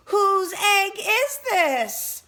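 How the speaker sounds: high, loud, drawn-out call. Acted. Boy asking around about a lost egg, calling out. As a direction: call out slowly for an owner to answer. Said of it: "he called".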